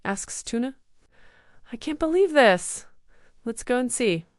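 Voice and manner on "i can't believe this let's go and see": high excited disbelief; surprised. A narrator's reading. Girl surprised by robot's note.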